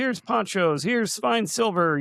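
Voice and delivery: high-pitched mock hawker's call; mock street-market sales yell; yelling market vendor. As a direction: imitate pushy stallholders calling out their wares, pitched well up. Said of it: "everybody's yelling at you like".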